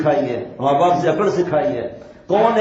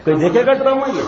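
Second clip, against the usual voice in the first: a laughing question, higher and louder; 7 semitones higher, 4 dB louder, faster than usual. Real, not acted. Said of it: "laughing mocking question". (ہنستے ہوئے طنزیہ سوال)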